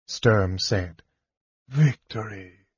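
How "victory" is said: soft, fading whisper of a dying knight; faint breath of a word. Acted. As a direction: let the word slip out with the last breath. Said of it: "Derek whispered".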